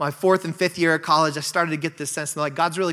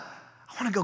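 much softer restart; quiet; 3 semitones higher, 11 dB quieter than usual. Real, not acted. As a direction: drop the voice right down. Repeats phrase, loud then quiet.

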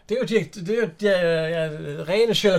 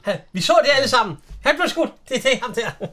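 excited quoting; 8 semitones higher, 6 dB louder than usual. Retold or imitated. Echoing the actress from the film.

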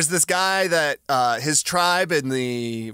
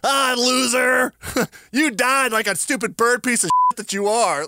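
mock cruel taunt; 8 semitones higher, 3 dB louder than usual. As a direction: play a cartoonish bully jeering. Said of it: "but I wasn't like".

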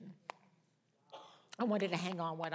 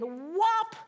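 loud, high swat sound effect; explosive rising strike noise; imitated cat swipe; 12 semitones higher, 9 dB louder than usual. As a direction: fire off a big "whop".

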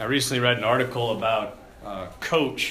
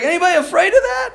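laughing coach; 5 dB louder, faster than usual. Retold or imitated. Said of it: "And he's like".

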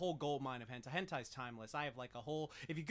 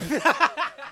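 loud laugh; 10 semitones higher, 14 dB louder than usual. Real, not acted. Laughs at own joke.

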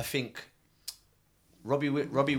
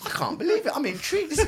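much higher, squealing disbelief; mock amazement. Real, not acted. Cab driver hamming up his shock at the back seat. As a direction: play up shocked delight.

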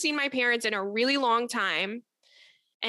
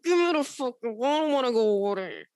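Tony Soprano. Retold or imitated. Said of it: "Like Tony Soprano".

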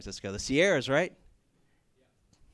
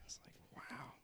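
breathy whisper of wonder; whispered aside; 20 dB quieter than usual. Real, not acted. Pastor whispers his amazement at the conversation, hushed. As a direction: lean in and whisper it to yourself.

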